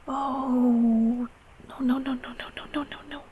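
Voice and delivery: fading, worried murmur; flustered soothing. Acted. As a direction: hush them anxiously, trailing off. Hushing a crying adult.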